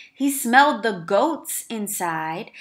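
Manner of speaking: plain reading voice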